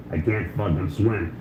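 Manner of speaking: slow, soft, low trach rasp. Retold or imitated. Comedian imitates the date's punchline, slow trach voice. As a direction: drag it out through a trach.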